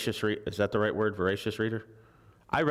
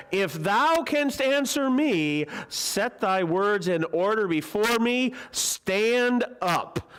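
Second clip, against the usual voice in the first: much higher, louder, slow demand; challenging Elihu; 10 semitones higher, 4 dB louder, much slower than usual. Acted. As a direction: thunder a dare at the sufferer.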